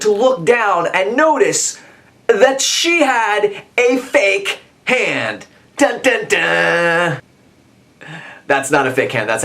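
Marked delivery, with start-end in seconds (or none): 2.3-5.5 slow, high, drawn-out reveal; milk the shocking reveal; slow suspense line; dramatic reveal. Acted. 5.8-7.2 voiced dun dun dun sting.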